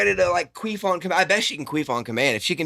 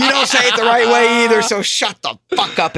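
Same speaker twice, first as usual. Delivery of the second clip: louder, higher comic telling-off; mock angry; 6 semitones higher, 4 dB louder than usual. Real, not acted. Scolding imagined Australians, mock angry shout. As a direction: yell it in fun.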